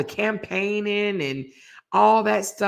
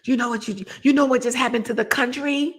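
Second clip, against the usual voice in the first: mad colonel; 5 semitones higher than usual. Retold or imitated.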